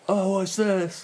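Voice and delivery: high-pitched befuddled elder; mock senile wondering; confused old-timer. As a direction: put on a high, puzzled old voice. Playing one of them grown old.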